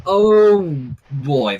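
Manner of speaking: ordinary talk, nothing marked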